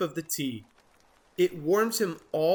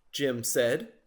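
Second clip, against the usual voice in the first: ordinary delivery, nothing marked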